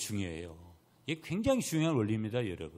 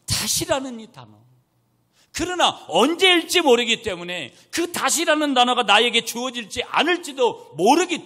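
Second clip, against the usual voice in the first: loud, high, driving pulpit delivery; forceful preaching; 12 semitones higher, 12 dB louder than usual. Real, not acted. Preacher proclaiming God's second chances, impassioned preaching. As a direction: proclaim it to the whole congregation.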